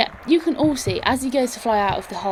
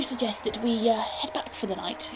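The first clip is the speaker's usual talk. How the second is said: soft whisper; 7 dB quieter than usual. Acted. Esther speaks softly.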